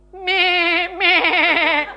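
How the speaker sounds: long, wavering sheep bleat; drawn-out "meh" with a wobble. Acted. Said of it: "Sheep bleat".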